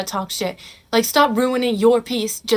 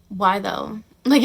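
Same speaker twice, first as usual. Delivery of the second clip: usual speaking voice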